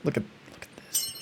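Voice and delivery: fast awed whisper; hurried breathy whisper. Real, not acted. Pointing out the drawing to viewers, fast whisper.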